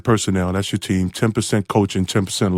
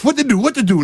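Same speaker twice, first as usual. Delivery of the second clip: panicking; 10 semitones higher than usual. Retold or imitated. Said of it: "Now I'm panicking".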